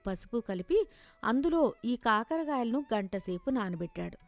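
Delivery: plain reading voice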